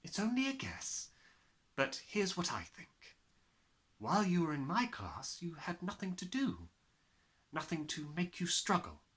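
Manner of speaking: teacher gently explaining to her pupil; kind teacher Miss Honey. Acted.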